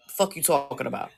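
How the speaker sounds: ordinary delivery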